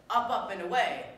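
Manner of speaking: loud, brief heroic call; superhero catchphrase. Acted. Would-be hero quoting the flying catchphrase, heroic. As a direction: toss it out like a caped hero taking off.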